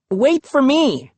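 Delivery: very high, slow called plea; a boy calling after the others. A narrator's reading. Brother calling from behind.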